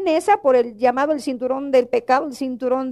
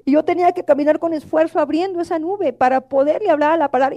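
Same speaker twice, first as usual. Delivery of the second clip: ordinary talk, nothing marked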